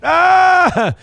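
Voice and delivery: pirate shout. Pretend. Pirate exclamation.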